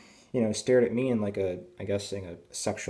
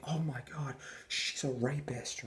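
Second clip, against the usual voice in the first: high, softer, drawn-out fake alarm; mock horror; 5 semitones higher, 7 dB quieter than usual. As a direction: feign scandalized shock in a high voice. Mocking an accusation he wouldn't make.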